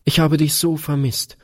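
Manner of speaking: put-on high-pitched little girl's voice; little daughter. A narrator's reading. Daughter telling Papa she missed him, high little-girl voice.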